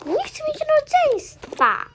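high, whiny play voice; high-pitched sibling grievance; squealed complaint. Pretend. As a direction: protest in a pretend squeal. Play figure rejects having siblings.

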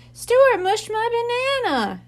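put-on high, loud little kid's wail; small redhead wailing to Lucky about the banana; child wailing. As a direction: wail it like a wronged toddler. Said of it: "the kid wailed".

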